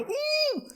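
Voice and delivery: very high mock-alarmed ooh; high-pitched "ooh". Acts alarm at good woman.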